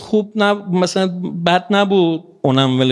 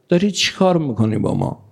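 usual speaking voice

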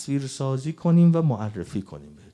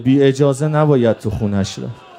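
raised, urgent preaching lament; mourning sermon; 6 semitones higher, 6 dB louder than usual. Real, not acted. Preacher recounting the assault on Ali to mourners, urgent lament. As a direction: press the grief on the congregation in long chanting phrases.

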